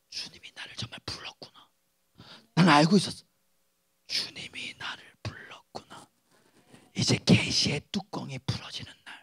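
soft, breathy whispered realization; awed whisper. Acted. Preacher whispering his awe that God called him. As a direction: hush it like a secret revelation.

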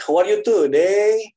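high, slow, hammed-up British English; put-on British accent. Mock posh British greeting. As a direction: lecturer mocking a student's fake British accent. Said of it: "Tapi logatnya logat British".

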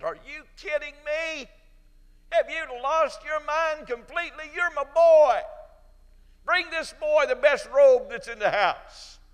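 put-on high, loud, jubilant father welcoming his boy; overjoyed father. Father welcoming home his lost son, high and jubilant. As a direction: shout for joy as the father. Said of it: "He said".

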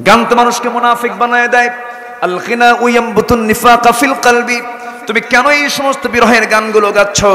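a preacher's stern scolding of a woman; stern rebuke. Acted. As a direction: call her out sternly, voice up. Umar rebukes the singing woman.

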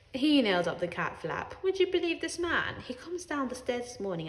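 plain reading voice

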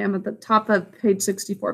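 plain reading voice

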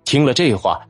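plain reading voice

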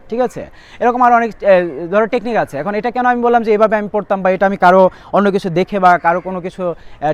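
ordinary talk

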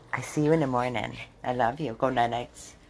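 low, soft tender goodnight to the dogs. Real, not acted. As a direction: say it softly, tucking them in. Saying good night to dogs.

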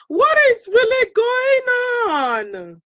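very high, loud wailed question; high exclamation. Real, not acted. Host asks viewers what is happening, in a high wail.

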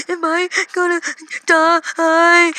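high, halting sobbed question; mock tearful. Eight-year-old asking parents after being shot, mock sobbing. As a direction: imitate a kid wailing through sobs. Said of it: "literally my words I said to my parents".

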